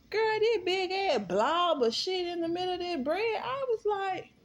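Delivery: high outraged storytelling. Real, not acted. Describing the gross sandwich.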